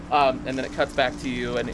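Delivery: usual speaking voice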